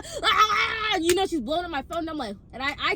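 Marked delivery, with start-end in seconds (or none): 0.0-1.0 high mock wailing cry; hammed-up bawling "ah, ah". Imitating a friend melting down, mock wail.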